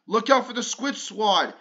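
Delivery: usual speaking voice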